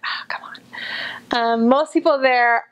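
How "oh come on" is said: soft whispered "come on"; whispered disappointment. Retold or imitated. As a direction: whisper it, mock let down. Disappointed at missing accent.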